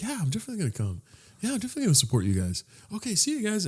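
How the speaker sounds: quick, soft imitation of a breezy girl; a hint of an eager girl, spoken fast and softly; lightly voiced friendly girl. Retelling a friendly girl's replies.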